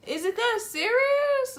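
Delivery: high, loud, stretched question; flabbergasted. Retold or imitated. Stylist at client's tears, loud incredulous squeal. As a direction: draw it out in disbelief.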